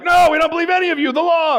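hammed-up rival crowd arguing back; mock argument. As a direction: fire it back as a quarreling opponent. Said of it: "they're like".

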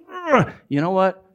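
groan, then push it out in mild annoyance; frustrated. Acted. Falsely accused believer losing patience, acted frustration. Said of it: "when you get angry and you're frustrated".

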